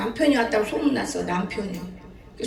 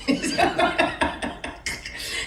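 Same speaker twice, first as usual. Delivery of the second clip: high squealing laugh; 4 dB quieter than usual. Real, not acted. Preacher laughing at her child's words, high squeal.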